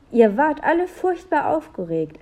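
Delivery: put-on high-pitched, lively small boy; little boy. A narrator's reading. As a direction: voice an eager little brother. Little brother Olli recounting his spying.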